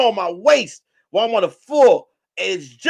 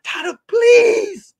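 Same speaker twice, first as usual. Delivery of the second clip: high, drawn-out plea; exaggerated whining plead for mercy; mock begging; 11 semitones higher, 3 dB louder, much slower than usual. Grabbed by the collar, begging Donna in mock panic.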